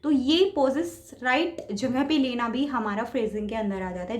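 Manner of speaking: normal talking voice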